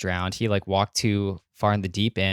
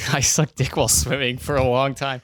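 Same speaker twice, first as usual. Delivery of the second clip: laughing while teasing; 3 semitones higher, 3 dB louder than usual. Real, not acted. Teasingly repeating his friend.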